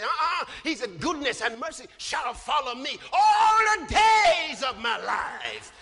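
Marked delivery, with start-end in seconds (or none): 1.0-5.7 loud preacher's proclamation swelling on "all the days"; emphatic declaration. Retold or imitated. Preacher correcting what David said, loud bold declaration. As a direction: proclaim it, stretching the last words.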